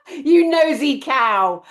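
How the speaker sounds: loud, slow mock scold. Real, not acted. Teasing her friend for eavesdropping, loud and slow. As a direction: tease her like a scandalised friend.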